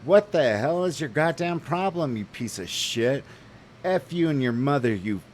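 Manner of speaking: high, loud cursing tirade; retold furious shout. Cursing out the driver in the parking lot, loud and high. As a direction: scream insults at him. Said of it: "I'm like".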